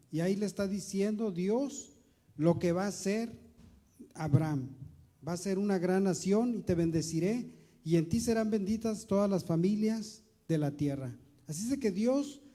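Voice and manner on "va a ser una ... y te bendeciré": usual speaking voice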